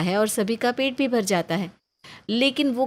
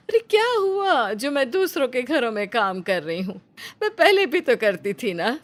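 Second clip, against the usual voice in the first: higher, pleading mother brushing off worry; mother's voice; 4 semitones higher than usual. Acted. Mother Nandita defending her work to her son, reassuring. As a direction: reassure your son you've done this work before.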